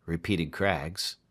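ordinary delivery, nothing marked

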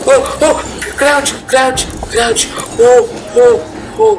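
very high, frantic urging; panicked. Real, not acted. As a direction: squeak it out in panic. Hiding from chasing monster.

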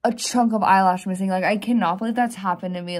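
exasperated. Real, not acted. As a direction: vent about it loudly. Upset about missing eyelash.

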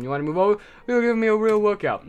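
talking rock character. Acted. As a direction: voice a rock grumbling at being moved. Voicing the rock being moved.

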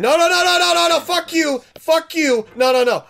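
high, loud panicked no's; frantic yelling. Real, not acted. Gamer yelling back at the narrator while falling, frantic yelling. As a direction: shout it out as everything slips.

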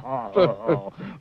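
hearty roaring laugh; a big roaring laugh; lion's laughter. Acted. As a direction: laugh like a lion that can't stop. Lion roars with laughter.

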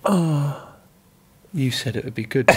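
soft, mock crestfallen complaint; dejected. Abused singer back in his seat, quietly dejected. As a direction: imitate a let-down friend, quietly. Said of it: "he was sat there like this".

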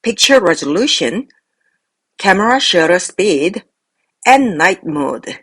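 slow list with pauses after "resolution" and "speed", falling on "night mode"; parallel list intonation. Shown as a demonstration. Teacher models a parallel structure, slow list intonation. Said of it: "병렬 구조로는".

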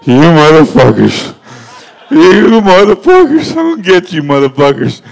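slow, loud, menacing threat; angry inspector on phone. Retold or imitated. Code inspector threatening the sign crew, slow loud menace. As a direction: growl the threat slowly down the line. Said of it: "And I was like, "Dude, that's code compliance".

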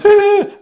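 loud high shocked gasp; sharp theatrical gasp; mock gasp. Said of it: "they've gone".

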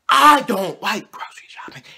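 high secret whisper; whispered confession. Real, not acted. Vlogger admitting he hates grocery shopping, whispered.

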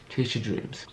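soft murmured chase your dreams; quiet echo. Real, not acted. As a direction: drop it to a hush. Echo after hyped call.

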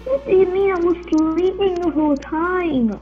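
ordinary talk, nothing marked

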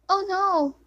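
high, loud dismayed cry; alarmed cry. Real, not acted. Player crying out when things go wrong.

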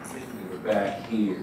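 ordinary talk, nothing marked

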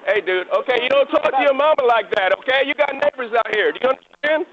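loud, stern telling-off; shouting reprimand. Real, not acted. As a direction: shout him down like a cop scolding a grown man. Officer shouting down a yelling man.

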